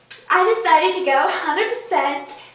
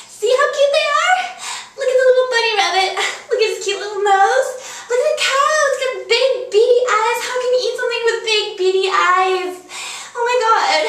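gushing over cuteness; 6 semitones higher than usual. Acted. Gushing over cute animal drawings.

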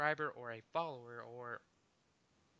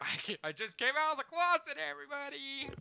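mock announcement; 11 semitones higher, 4 dB louder than usual. Joking announcement to everyone.

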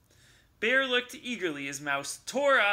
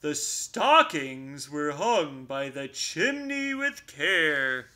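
poem recital; 5 dB louder, slower than usual. Acted. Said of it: "Bear continued".

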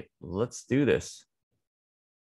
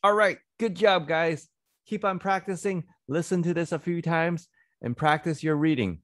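cheery praise; 7 semitones higher, much faster than usual. Real, not acted. Teacher praises children after reading, high and cheery.

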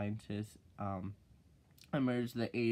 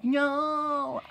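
high, loud deadpan no; flat mock no; 14 semitones higher, 8 dB louder than usual. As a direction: shut her down with one word. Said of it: "I'm like".

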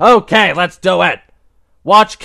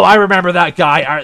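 ordinary talk

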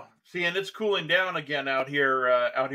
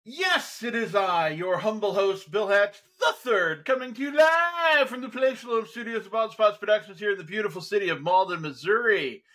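raised-pitch, grand show-host introduction; showman announcer; 5 semitones higher than usual. Acted. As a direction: announce yourself and your guest with a flourish. Host announces himself and guest.

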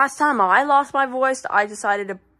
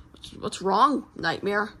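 ordinary talk

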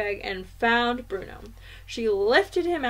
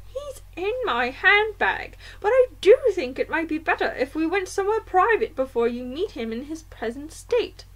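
high-pitched, louder grandmother voice; indignant grandmother; 6 semitones higher, 3 dB louder than usual. A narrator's reading. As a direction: speak up as an affronted old grandmother.

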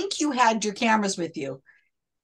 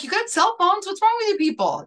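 much higher, exasperated mock scolding; retold outrage; 9 semitones higher than usual. Scolding the friends in the dream, high and exasperated. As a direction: berate them as if they're idiots, pitched up. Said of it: "I'm like".

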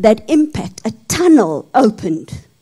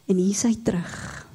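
awed whisper; 3 semitones lower, 10 dB quieter than usual. A narrator's reading.

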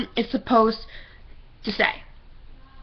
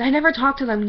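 ordinary talk, nothing marked

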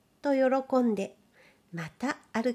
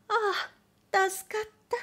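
lady's relief; 10 semitones higher, faster than usual. Acted. Lady voiced in relief.

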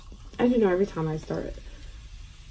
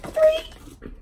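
high squeak. Acted. Sudden squeaky exclamation.

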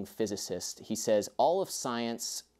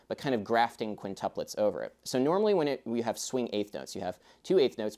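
ordinary talk

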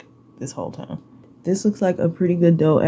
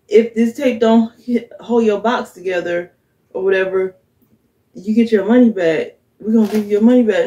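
mocking ad voice; 4 semitones higher, 7 dB louder than usual. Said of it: "they all like".

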